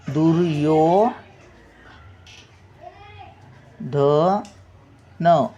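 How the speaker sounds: दुर्योधन stretched very slowly, half र then यो; very slow demonstration. Shown as a demonstration. Teacher modelling the conjunct for pupils, very slow. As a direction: drag दुर्योधन out syllable by syllable. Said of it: "रचा पहिला उच्चार येतो ना".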